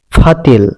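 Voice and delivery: পাতিল said as ফাতিল with f; Chittagong dialect pronunciation. Shown as a demonstration. Teacher showing the Chittagong p-to-f shift in ফাতিল. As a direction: blow the p into f in ফাতিল. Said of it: "প ধ্বনিকে ফ উচ্চারণ".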